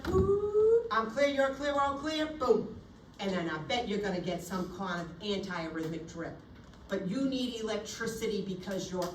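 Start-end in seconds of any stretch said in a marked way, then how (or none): 0.0-2.7 mock shock call. Acted defibrillator shock.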